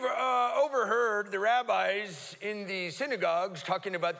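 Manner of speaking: high, loud, slow nerdy asking; mock pedantic question. Blind men mock-asking Jesus a fussy theology question, hammed-up. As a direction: ham up a know-it-all question.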